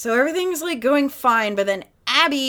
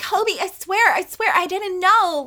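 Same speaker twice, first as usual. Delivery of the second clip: high, pleading, a bit louder; pleading woman; 9 semitones higher, 5 dB louder than usual. Retold or imitated. Lexi pleading with Kobe that she didn't know, high and pleading. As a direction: beg him to believe her.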